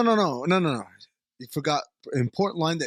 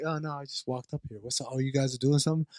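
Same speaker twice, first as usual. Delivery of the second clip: fast, much softer, breezy and offhand; mock casual cool; 4 semitones lower, 7 dB quieter, faster than usual. Podcaster mocking alien Brax faking nonchalance, softer and quick. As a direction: play it cool like nothing happened, quick and low-key. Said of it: "he tries to act cool".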